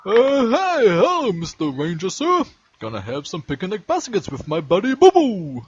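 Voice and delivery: high, hammy cartoon bear; Yogi Bear voice. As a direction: play it as a jolly cartoon bear. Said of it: "That's pretty good".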